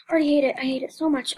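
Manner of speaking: rushed, panicked and fast. Real, not acted. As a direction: blurt it out quickly.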